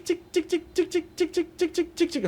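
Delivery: high, soft chirps far above the speaking voice; high squeaky mock birdsong; bird chirping imitation. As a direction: chirp like a small bird at dawn. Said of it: "bird सुबह चिक".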